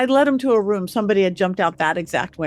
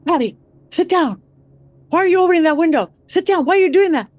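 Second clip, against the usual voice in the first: loud, high shout to sit down; retold yelling; 8 semitones higher, 4 dB louder, faster than usual. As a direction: yell it at her, alarmed. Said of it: "Zach starts yelling at me".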